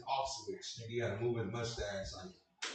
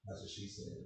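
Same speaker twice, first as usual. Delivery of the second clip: muttered aside; 6 semitones lower, 13 dB quieter than usual. Real, not acted. Quiet punchline aside.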